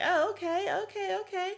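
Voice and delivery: high-pitched mock fluster; mocking flustered Wendy. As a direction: squeak it like a caught-off-guard pushover. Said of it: "And then you're like".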